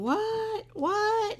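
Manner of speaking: hammed-up "What? What?" from a man hard of hearing; imitating husband. Husband who can't hear the caller, mock loud confusion. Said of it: "So he's like going".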